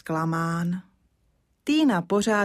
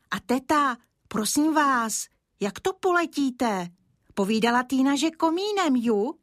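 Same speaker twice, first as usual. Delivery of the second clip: lightly voiced child; 7 semitones higher than usual. Child speaks to aunt.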